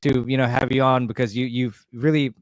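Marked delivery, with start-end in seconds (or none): none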